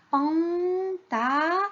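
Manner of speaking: slow, drawn-out syllables in "panta-"; sounding out. Shown as a demonstration. Teacher gives children a word missing its syllable, sounding it out. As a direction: stretch "panta-" and leave it hanging.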